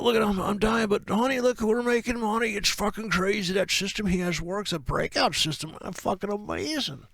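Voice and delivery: high, giddy bragging about the money; gloating trader. Acted. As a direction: crow over the winning trade.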